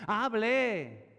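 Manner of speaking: loud commanding call. Real, not acted. Pastor urging church to pray aloud, loud command. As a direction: bark it at the congregation.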